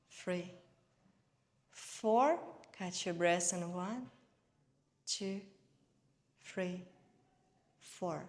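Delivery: unhurried meditation guide, evenly paced; guided meditation. Real, not acted. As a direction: guide them gently through each breath, leaving space. Leads breathing and guided meditation.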